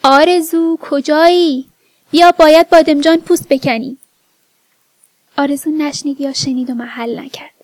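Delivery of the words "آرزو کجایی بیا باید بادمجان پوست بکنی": loud, raised call to someone in another room; acted household yell; narrated shout. A narrator's reading. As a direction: call her over to do her chores, loudly. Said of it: "خانجان داد زد".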